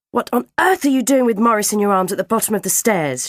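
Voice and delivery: outraged mother, high, loud and fast; rapid scolding cry; shriek. A narrator's reading. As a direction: demand an explanation at a shriek. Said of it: "shrieked mine".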